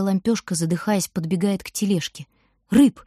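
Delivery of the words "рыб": calling out. A narrator's reading. Girl calls to the boy.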